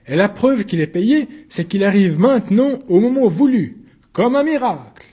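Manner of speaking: high, loud sarcastic argument. Acted. Accused arguing to the court, loud and high. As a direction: press the point with scorn.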